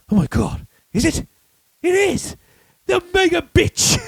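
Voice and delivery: dawning mock horror, climbing high; imitating a character's appalled discovery; horrified recognition impression. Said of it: "then he spots her. He goes".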